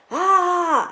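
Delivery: loud, high re-enacted cry of pain; retold scream. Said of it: "like, scream".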